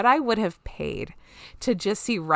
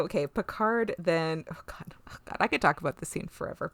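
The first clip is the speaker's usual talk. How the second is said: ordinary talk, nothing marked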